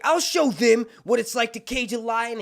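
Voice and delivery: high, loud mock defiance; defiant boast. Cersei newly jailed, acting out proud defiance. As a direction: declaim it like a caged queen.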